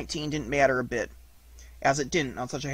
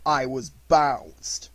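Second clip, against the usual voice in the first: very slow, glum pronouncement; slow, mournful donkey; aggrieved Eeyore; 4 dB louder, much slower than usual. Acted. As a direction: draw it out with wounded dignity.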